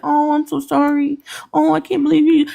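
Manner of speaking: high, gushing mock sympathy; mock sympathizers. Mocking the supporters' gushing pity. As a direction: ham up fake pity from online supporters. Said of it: "You just wanted people up here saying".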